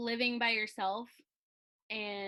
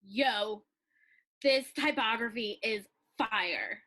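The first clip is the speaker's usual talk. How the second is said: slow, menacing villain line; 6 dB louder, slower than usual. Pretend. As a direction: play it like a cartoon villain. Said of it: "In your best villain voice".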